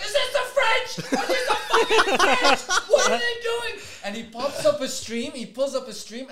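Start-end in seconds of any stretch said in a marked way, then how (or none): 0.0-3.7 very high, loud squawk through laughter; mock panicked shouting. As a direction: yell it like a hammed-up freak-out. Streamer's panic acted out, laughing.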